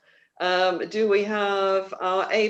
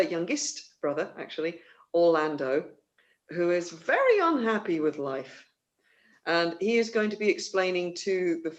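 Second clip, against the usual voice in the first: usual speaking voice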